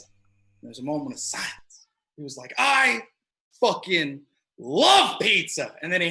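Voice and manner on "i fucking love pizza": slow, high, drawn-out declaration; imitated emphatic enthusiasm. Boyfriend answering about pizza, emphatically delighted. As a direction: lean hard on each word, with pauses.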